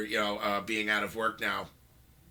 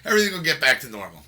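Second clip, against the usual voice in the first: blurted out; 4 semitones higher, 9 dB louder, faster than usual. Real, not acted. Blurting the forbidden phrase.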